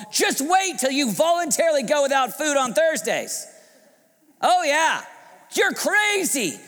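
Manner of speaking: high, loud mocking tirade; heated preaching. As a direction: preach at full force, taunting. Preacher mimics the scornful city.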